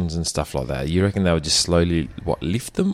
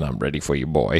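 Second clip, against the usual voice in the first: lightly voiced man, dropped lower; a hint of a man's voice; 5 semitones lower than usual. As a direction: say it in a slightly lowered manly voice. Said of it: "it was a man's voice going".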